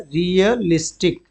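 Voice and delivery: Realistic drawn out slowly, syllable by syllable; slow teacher demo of realistic; slow model pronunciation. Shown as a demonstration. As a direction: say realistic slowly and clearly for the class. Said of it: "क्या होता है?".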